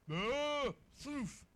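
loud mock moo; cow moo imitation. Preacher mimics his cows calling to him, mock moo. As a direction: moo like a cow greeting you.

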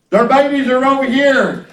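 ordinary talk

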